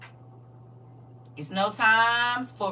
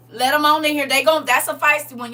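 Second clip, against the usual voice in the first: louder, higher, laughing through it; raised, teasing call; playful excitement; 6 semitones higher, 9 dB louder than usual. Pretend. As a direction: hype up the crowd. Playful crowd instructions.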